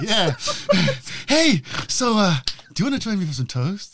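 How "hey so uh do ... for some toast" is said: high, awkward film-hero invitation; Nacho. Retold or imitated. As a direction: quote the comic hero, pitched up with hesitations. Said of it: "the bit where he's like".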